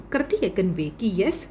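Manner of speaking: ordinary talk, nothing marked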